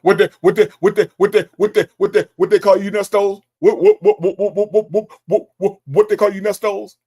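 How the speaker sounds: loud, stuttering mock of an excited friend who can't get the words out; mocking a friend too excited to finish his sentence, loud stammer. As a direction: sputter the repeated "what" loudly, tripping over it. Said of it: "one of them people get excited, he can't get them words out".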